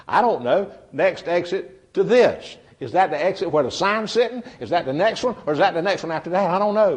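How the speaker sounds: exasperated driver. Acted. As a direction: throw up your hands at the sign.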